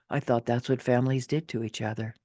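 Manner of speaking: plain reading voice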